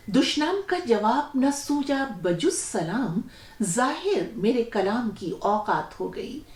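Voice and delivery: ghazal recitation. Acted. Host reciting the poet's full ghazal to listeners, verse recitation. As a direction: recite each line with pauses between.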